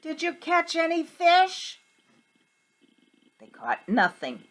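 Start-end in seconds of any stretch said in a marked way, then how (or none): 0.0-1.8 yelled. Acted. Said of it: "he yelled out to them".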